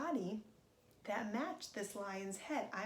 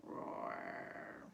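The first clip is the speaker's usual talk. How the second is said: lion roar; 4 dB quieter than usual. Pretend. Voicing the lion again.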